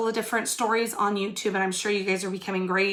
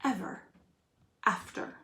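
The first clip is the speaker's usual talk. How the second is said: soft storybook ending; 10 dB quieter than usual. Acted. Fairy tale closing line.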